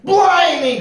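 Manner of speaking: very high, loud pirate exclamation; crying out. A narrator's reading. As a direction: cry out as an appalled captain. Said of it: "cried Pete".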